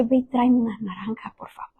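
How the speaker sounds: soft, fading sick woman's request; acted weak plea. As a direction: imitate a parched patient asking faintly, trailing to almost nothing. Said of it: "me sentía seca".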